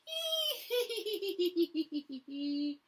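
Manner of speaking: put-on high childish giggle; boy's giggle. Boy laughing at the dragon, high childish giggle. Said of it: "The boy laughed".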